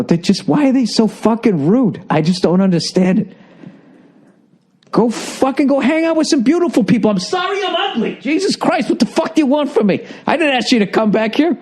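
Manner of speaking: retold rant, climbing high in pitch; going off. Comic retelling his venting phone call to Nia, ranting. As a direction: rant in high, exasperated bewilderment. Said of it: "I was just going off on it".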